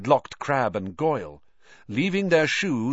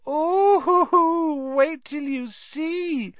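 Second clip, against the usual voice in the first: ghost voice; slower than usual. A narrator's reading. Moaning Myrtle's gleeful ghost voice.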